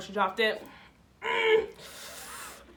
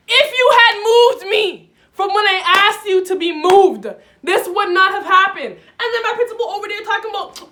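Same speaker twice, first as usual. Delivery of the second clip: loud, higher complaint; indignant rant; 9 semitones higher, 12 dB louder than usual. Real, not acted. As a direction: vent at the teacher. Ranting at teacher.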